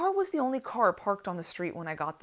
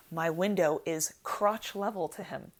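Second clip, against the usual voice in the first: ordinary talk, nothing marked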